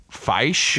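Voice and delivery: "feish" with the long I of sci-fi, rising. Shown as a demonstration. Host tries out the odd plural, "feish" with a long I. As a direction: say "feish" to rhyme its vowel with "sci-fi". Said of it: "pronounced as though with a Y, like in sci-fi".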